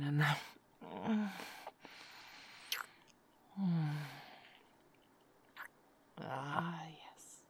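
hushed afterglow murmur, mostly whispered; intimate whisper. Acted. Lover settling her partner to sleep, hushed whisper.

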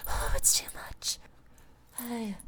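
breathless unvoiced whisper; overwhelmed whisper. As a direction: let it slip out as a whisper. Overwhelmed lover in roleplay.

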